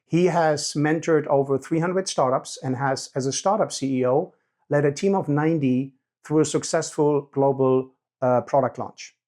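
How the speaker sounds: loud, measured presenter read; scripted podcast introduction. Real, not acted. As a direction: read the guest bio aloud to podcast listeners.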